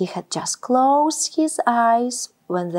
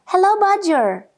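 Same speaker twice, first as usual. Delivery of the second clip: a bright, much higher and louder hello; cheery greeting; 7 semitones higher, 4 dB louder than usual. A narrator's reading. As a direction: greet a friend with delight. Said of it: "he said cheerily".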